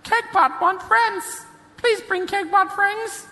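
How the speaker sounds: high, slow robot chant; a needy little robot pleading. Acted. GM voicing the Kegbot robot.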